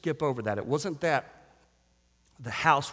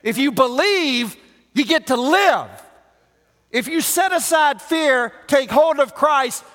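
loud, high, shouted sermon; fiery preacher at full volume; full-force preaching; 12 semitones higher, 10 dB louder than usual. Real, not acted. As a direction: preach it hard to the back row.

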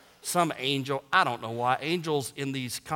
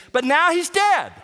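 raised, higher-pitched pulpit voice; full-force preaching; 8 semitones higher, 5 dB louder than usual. Acted. Preacher telling congregation death ends the sentence, full-force preaching. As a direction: drive each phrase home to the congregation.

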